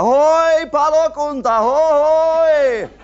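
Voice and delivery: high, loud, slow mock cries for help; calling for help. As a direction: holler it high like lost in the woods. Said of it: "No minä huusin apua".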